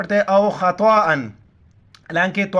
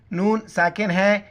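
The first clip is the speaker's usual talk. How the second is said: normal talking voice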